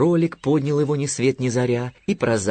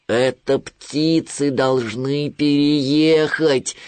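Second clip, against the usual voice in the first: slow, raised triumphant bear cry; 4 semitones higher, 4 dB louder, much slower than usual. A narrator's reading. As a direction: call out the discovery, stretching every word. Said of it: "наконец вскричал медвежонок". (протяжно вскричать)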